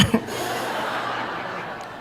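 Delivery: soft unvoiced laugh at his own punchline; breathy laugh. Real, not acted. Laughing at the counselor's reply, soft and airy. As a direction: let a quiet airy laugh escape.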